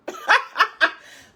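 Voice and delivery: loud, high cackling laugh; burst of laughter. Real, not acted. Vlogger laughing at her own roast, loud high cackle. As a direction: crack up at the joke.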